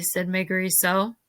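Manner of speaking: usual speaking voice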